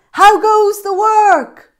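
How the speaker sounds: high, loud, slow bossy call; lazy farmer calling out to the duck, high and loud. Acted. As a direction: call down lazily from bed.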